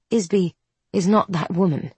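plain reading voice

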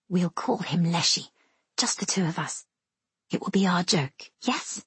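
soft, near-whispered secret between two; hushed, confiding. Acted. Sister shares a secret joke with little brother, hushed. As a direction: let them in on a private joke, softly.

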